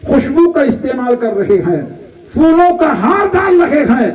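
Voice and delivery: fervent sermon cry; preaching at full force. Acted. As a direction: build to a passionate peak. Sermon builds again.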